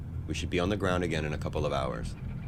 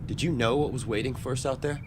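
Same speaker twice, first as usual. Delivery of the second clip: higher-pitched, pointed questioning; confronting; 6 semitones higher than usual. Acted. Survivor confronts a colleague about the old mission, accusing. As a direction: press them on what they knew.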